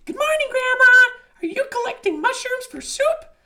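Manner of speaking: very high, loud little bear voice; Baby Bear calling. A narrator's reading. Baby Bear greets the disguised wolf, high call. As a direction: call out cheerily like a tiny cub. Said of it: "Baby Bear called out".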